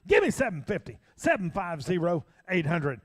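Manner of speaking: fast rhythmic auction chant; auctioneer. Acted. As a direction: call the bids like an auctioneer. Auctioneer bid calling.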